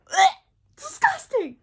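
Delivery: high, loud grossed-out cry; disgusted outburst. Real, not acted. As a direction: recoil in disgust. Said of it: "disgust reaction".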